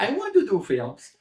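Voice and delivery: high-pitched copy of a friend's voice; mimicked eager voice. Director mimicking the actor's yes to films, high voice. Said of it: "Just like that".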